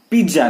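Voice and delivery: "पिज्जा" as PID-ja; wrong J sound. Shown as a demonstration. Teacher showing another mistake, wrong J sound. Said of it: "पिज्जा नहीं".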